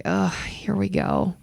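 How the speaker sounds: soft, slow resigned groan; weary dread. Retold or imitated. Passenger spotting trouble on the plane, weary dread. Inner groan on seeing the man.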